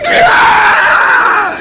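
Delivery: high, loud imitated scream. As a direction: scream at the top of your lungs. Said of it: "she's screaming at the top of her lungs".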